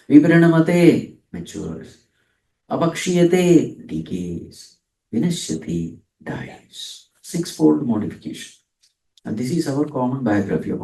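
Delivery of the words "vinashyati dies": normal talking voice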